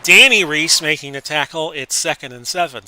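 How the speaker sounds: play-by-play call. Acted. Announcer to viewers, live play-by-play.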